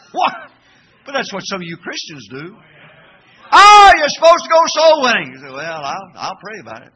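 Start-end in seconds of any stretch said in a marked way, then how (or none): 3.5-5.3 high, loud, hammed-up reminder; mock exclamation. Mock exchange with reluctant Christians. 5.5-6.9 soft, noncommittal excuse; mock reluctance.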